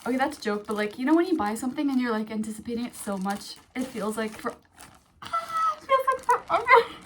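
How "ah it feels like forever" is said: very high, loud squeal; high-pitched excited shriek; impatient squeal. Real, not acted. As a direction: squeal with unbearable anticipation. Overexcited waiting to open package.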